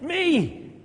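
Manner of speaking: high, loud call. Acted. Beggar calling Jesus over through the crowd, high and loud. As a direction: wave him over with your voice.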